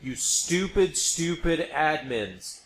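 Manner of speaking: usual speaking voice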